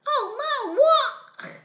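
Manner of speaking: high, loud piglet protest; a little pig whining at mum; whiny little pig. Acted.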